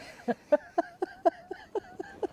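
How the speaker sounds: squealing, high laughter; high giggle. Real, not acted. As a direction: giggle in disbelief. After hearing about fall.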